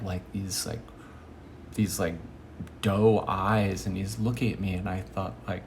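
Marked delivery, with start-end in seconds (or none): none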